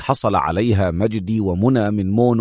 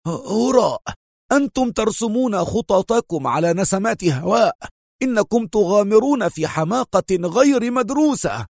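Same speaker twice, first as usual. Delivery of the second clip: much higher, sharp scolding cry; sharp angry exclaim; 10 semitones higher than usual. A narrator's reading. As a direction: dismiss their plan with heat. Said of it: "لوح القنصل بذراعه كلها في حدة وهو يهتف".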